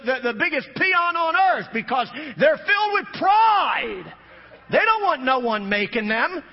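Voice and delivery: preaching at full force. Acted. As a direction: preach hard against the proud.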